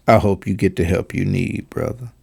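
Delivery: low, soft imitation of hurt solemnity; mock sullen. Commentator parroting the athlete's sign-off, low mock-sullen voice. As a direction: drop your voice and parody his grave sign-off. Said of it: "to a sullen".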